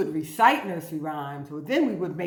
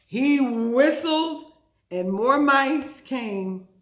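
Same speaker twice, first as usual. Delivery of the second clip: slow, louder storybook reading; dramatic reading; 6 semitones higher, 7 dB louder, much slower than usual. A narrator's reading. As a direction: build suspense slowly and loudly.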